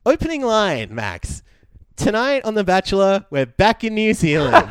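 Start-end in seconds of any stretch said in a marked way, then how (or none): 2.0-4.7 a hint of the show's announcer; lightly voiced TV opener, laughing; quoting the show. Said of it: "They officially said it".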